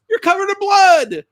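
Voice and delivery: mock panic as the wife; frantic wife telling husband about blood; wife's alarmed reply. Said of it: "And she says".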